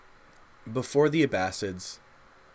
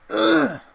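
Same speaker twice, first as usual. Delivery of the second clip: disgusted groan; 7 dB louder than usual. Real, not acted. Reacting while streaming.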